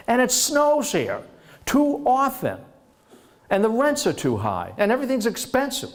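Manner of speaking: high, whining mock complaint; whiny complaining Virginian. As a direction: moan about New York like a spoiled Virginian. Virginians mocked complaining about New York.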